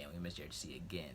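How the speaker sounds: whispered under the breath; hushed quick whisper. Real, not acted. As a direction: breathe it like a secret aside. Unvoiced whispered aside.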